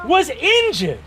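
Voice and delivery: high, loud, slow accusation; accusing knight. Acted. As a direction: draw out the outrage in a high knight voice.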